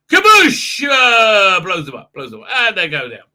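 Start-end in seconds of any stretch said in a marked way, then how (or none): 0.1-0.8 very high, loud cartoon boom; explosion noise. Borg ship blasting starships, loud mock explosion. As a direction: burst it out like a big explosion.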